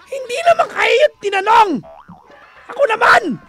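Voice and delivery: high, loud comic yelling; mock angry shout. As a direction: yell in put-on outrage at the listeners. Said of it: "Ako yung galit eh". (pabirong galit na sigaw)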